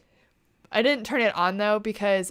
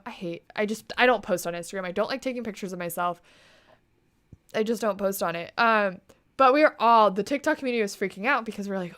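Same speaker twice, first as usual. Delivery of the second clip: ordinary delivery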